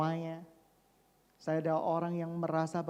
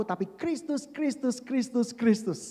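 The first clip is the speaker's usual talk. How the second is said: usual speaking voice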